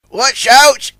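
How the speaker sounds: high, loud warning; yelling. A narrator's reading. The dredger warns Warrior of the swinging barge, yelling. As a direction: yell the warning out. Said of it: "yelled Little Dredger".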